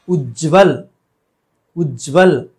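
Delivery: उज्जवल with held "jj" joined to v; double half j. Shown as a demonstration. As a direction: say "uj-jval" for उज्जवल. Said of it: "ये सही है".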